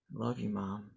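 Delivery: low, quiet and downcast; sad. Shown as a demonstration. Child to mom, hiding hurt, quietly sad. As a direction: say it softly, sunk in sadness. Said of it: "you can hear when someone's sad".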